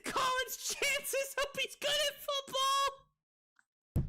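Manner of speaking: very high, slow squeal through laughter; real high-pitched hysterics; helpless laughing. Real, not acted. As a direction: squeeze the words out while cracking up. Cracks up reading a roast joke.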